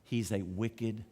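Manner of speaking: low, soft, measured accusation; name the villain slowly and quietly; hushed, deliberate acted verdict. Esther accuses Haman to the king.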